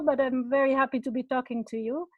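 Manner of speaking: ordinary talk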